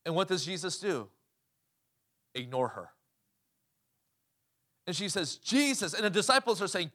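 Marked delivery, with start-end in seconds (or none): 5.4-5.9 pleading call. Acted. Woman crying out to Jesus.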